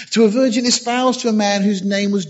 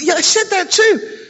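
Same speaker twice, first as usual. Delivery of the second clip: mock-hurt Gabriel; 13 semitones higher, 3 dB louder than usual. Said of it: "Gabriel getting back to heaven".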